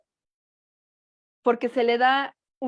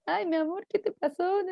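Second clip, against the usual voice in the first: put-on soothing a hurt child; 7 semitones higher than usual. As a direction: coo it like a mother soothing her baby. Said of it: "eso fue lo que hice".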